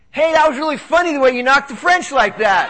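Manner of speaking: high, loud, perky imitation; retold gushing email. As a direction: voice an amused fan piling on praise. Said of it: "she sends me an email".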